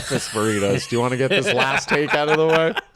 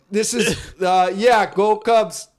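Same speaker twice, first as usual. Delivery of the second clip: higher, slow, laughing fake Cubs-fan voice; put-on phone voice; 7 semitones higher, 4 dB louder, slower than usual. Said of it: "I could have a phone call where I'm like".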